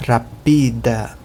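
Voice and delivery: rapida slow, with stress on pi. Shown as a demonstration. Teacher shows Esperanto stress on pi. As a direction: rapida as ra-PI-da, slowly. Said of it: "mas em Esperanto é o pi".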